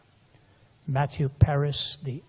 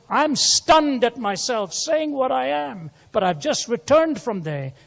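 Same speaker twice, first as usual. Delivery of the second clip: higher and louder, slow start; preaching emphasis; 9 semitones higher, 7 dB louder than usual. Retold or imitated. Preacher voicing stunned writer to congregation, loud. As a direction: ring out the writer's astonishment.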